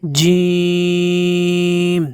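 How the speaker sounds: "جیم" stretched very long and slow; drawn out. Shown as a demonstration. Teacher showing the stretch: a long, slow "جیم". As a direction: hold the vowel of "جیم" for a long time. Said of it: "اس کو کھینچ کر پڑھنا ہے".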